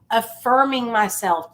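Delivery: ordinary talk, nothing marked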